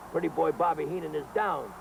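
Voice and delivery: normal talking voice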